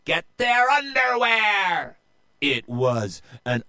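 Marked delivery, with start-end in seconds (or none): 0.0-2.0 very high, loud battle cry; rally the women to attack; pirate captain's shrieked order; calling to crew. Acted. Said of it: "called to her crew".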